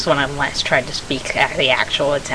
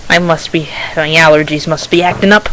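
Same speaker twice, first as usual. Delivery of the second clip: usual speaking voice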